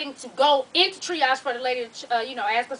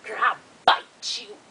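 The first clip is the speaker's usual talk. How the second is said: slow, low mock come-on; creepy flirting; 5 semitones lower, slower than usual. Man with jagged teeth flirts with triage lady, slow imitation. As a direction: imitate him slowly, drawing out the threat.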